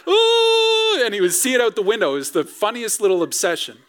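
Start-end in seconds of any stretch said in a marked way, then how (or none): 0.1-1.0 high, loud gleeful ooh; a thrilled child's ooh; toddler's awed ooh. Retold or imitated. Father imitates son seeing the bus, awed ooh. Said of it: "he'd go".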